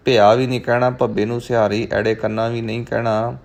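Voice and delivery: ordinary delivery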